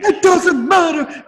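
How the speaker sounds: high, loud, slow shouted Rock catchphrase; hammed-up wrestler shout; Rock impression. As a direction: bellow it like The Rock on the mic. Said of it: "he actually did this".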